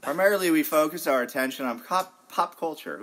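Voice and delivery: showman emphasis. Acted. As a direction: sell it like a showman. Host's Freudian slip sentence.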